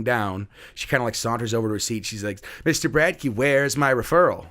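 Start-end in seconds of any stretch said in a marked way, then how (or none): 2.6-4.5 mock-demanding kid, pitched higher; demanding student. Student challenges teacher in front of class, mock demanding. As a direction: imitate a student calling the teacher out.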